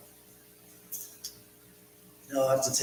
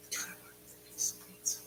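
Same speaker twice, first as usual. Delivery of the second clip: whisper; 13 dB quieter, faster than usual. Real, not acted. Hungry aside while others sleep.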